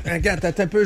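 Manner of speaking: usual speaking voice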